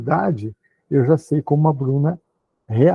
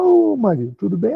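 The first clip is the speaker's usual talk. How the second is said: higher, lightly voiced sweet greeting to her husband; a hint of a wife checking in; 6 semitones higher than usual. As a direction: greet him brightly as the wife.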